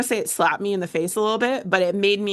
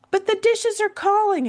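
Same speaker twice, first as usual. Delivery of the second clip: much higher, hammed-up despair; mock dramatic; 14 semitones higher than usual. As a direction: ham up the dishes calling. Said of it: "I'm like".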